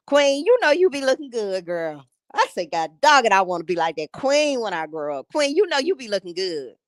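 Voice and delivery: usual speaking voice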